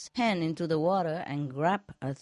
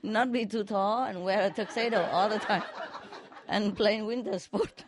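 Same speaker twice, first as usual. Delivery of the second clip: laughing through recap, higher; giggling as you repeat it; amused laughing recap; 6 semitones higher than usual. Real, not acted.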